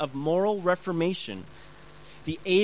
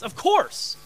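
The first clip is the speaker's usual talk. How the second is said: higher, indignant 'obviously'; annoyed retort; 7 semitones higher, 3 dB louder than usual. Acted. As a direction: answer as if insulted to be asked. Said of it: "they get very annoyed".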